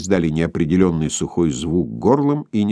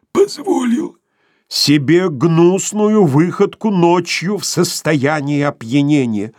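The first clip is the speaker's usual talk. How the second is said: higher, slow choked apology; hoarse gasping apology; 8 semitones higher, 4 dB louder, slower than usual. A narrator's reading. Sharikov, half-strangled, apologises to the women in a choked voice. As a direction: wheeze it out under pressure. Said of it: "перехватывая воздуху охрипший".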